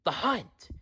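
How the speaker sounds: high, soft frightened gasp of words; breathless frightened hush; terrified whisper. A narrator's reading. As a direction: hush it out in terror. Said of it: "Vixen whispered".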